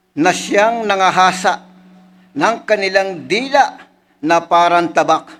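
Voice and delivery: elevated preacher's reading; scripture reading. Acted. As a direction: proclaim the psalm to the congregation. Reads a psalm aloud.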